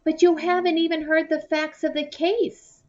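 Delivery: high, pleading protest. A narrator's reading. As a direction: object high and urgent. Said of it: "I protested".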